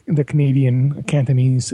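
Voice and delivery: usual speaking voice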